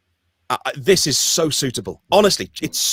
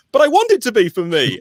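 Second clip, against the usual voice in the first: mock hurt protest; 8 semitones higher, 4 dB louder than usual. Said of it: "obviously it hurts a little bit 'cause they say".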